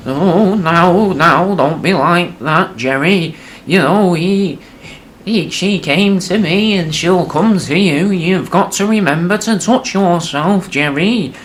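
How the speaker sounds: put-on high, slow, loud old man wail; doddering old dad begging, voice up high; pleading old man. Old dad to son Jerry, high pleading quaver.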